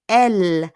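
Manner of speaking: ELLE stressed with an open e and held L; careful pronunciation. Shown as a demonstration. Teacher contrasts she with he for learners, careful ELLE. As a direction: punch ELLE as a long "ell". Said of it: "Be careful with your pronunciation".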